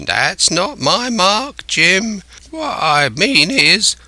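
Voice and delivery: high, slow, stretched-out castaway voice; Ben Gunn, bargaining. A narrator's reading. Castaway bargaining with Jim over his reward, canny.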